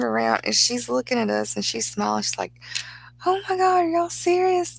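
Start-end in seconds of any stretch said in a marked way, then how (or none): 3.3-4.8 high, loud gasp of surprise, imitating mom; surprised mom. Mom hearing they'll marry tomorrow, high surprised squeal. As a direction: squeal out her shock like mom did. Mom reacting to wedding news.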